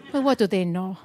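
ordinary talk

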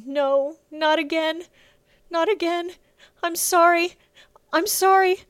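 high, anguished cry; tearful. A narrator's reading. Bella faces the armed men, crying in despair. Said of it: "Tears streamed down Bella's face".

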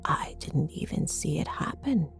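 soft, shaken whisper. A narrator's reading. Mother to daughter after the attack, whispered. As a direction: whisper it, almost to yourself. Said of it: "she whispered".

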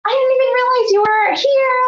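imitated very high-pitched surprise; squeaky-high, pleased greeting; delighted surprise. Midwife to patient she stumbled upon, happily surprised.

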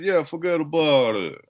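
high Italian-American wiseguy reply; mock Italian accent. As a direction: brush it off in a hammed-up Italian accent. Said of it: "Italian accent".

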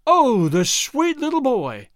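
high, slow cooing sweetness; fussy lady cooing over a little boy. A narrator's reading. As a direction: coo it like a gushing actress to a child. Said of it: "cooed Miss Irma".

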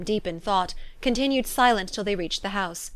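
ordinary delivery

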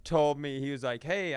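usual speaking voice

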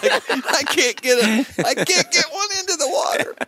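frustrated starfish thrower. Pretend. Man failing to save the starfish, mock exasperated whine. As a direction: whine it like a thwarted do-gooder. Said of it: "He's like".

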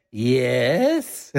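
the word breaking into a laugh. Retold or imitated. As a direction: try to say yes and crack up. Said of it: "We just started laughing so hard".

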